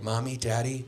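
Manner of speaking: put-on soft, partly whispered child; a hushed earnest child; kid voice. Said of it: "Your kids are gonna be like".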